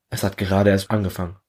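ordinary delivery

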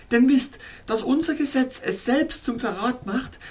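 alarmed pleading. A narrator's reading. Princess in alarmed refusal.

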